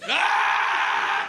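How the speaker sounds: long drawn-out loud yell into nothing; mock wordless yell. Comic imitating a homeless man yelling into the void, drawn-out yell. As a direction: bellow it out like a lost man.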